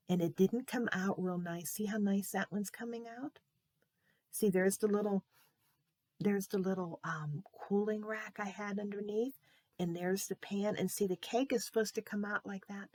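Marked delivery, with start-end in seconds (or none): none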